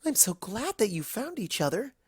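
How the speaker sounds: high, gentle pony voice; softly pleased; glad Fluttershy. A narrator's reading. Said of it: "grinning happily".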